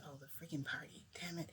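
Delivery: soft unvoiced muttered complaint; whispered grumble. Toshinori, reminded of the party, whispered grumble. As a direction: grumble it under your breath.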